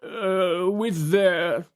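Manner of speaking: high, hesitant judge voice; peering judge. A narrator's reading. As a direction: read the papers while talking. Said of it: "looking through his double eyeglass".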